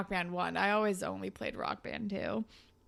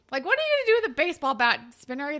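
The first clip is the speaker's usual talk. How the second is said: fast, higher, louder mocking question; incredulous mockery; 6 semitones higher, 6 dB louder, much faster than usual. Real, not acted. Friend mocks Spinner for bringing a bat, fast incredulous. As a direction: scoff at him in a rapid burst. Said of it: "a dummy".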